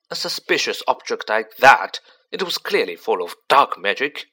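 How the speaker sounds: loud, exasperated telling-off; angry dad lecturing his child; scolding father. Acted.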